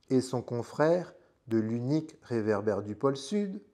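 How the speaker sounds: ordinary delivery, nothing marked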